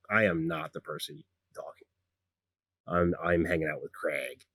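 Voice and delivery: low, soft, unenthused reply; low-key brush-off. Acted. Walker turning down the eager gnome, low and quiet. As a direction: decline quietly, keeping it low.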